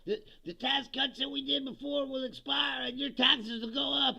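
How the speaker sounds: high, loud mocking of a politician's warning; mock scare pitch. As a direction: shout it like a fearmongering politician. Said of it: "It was like".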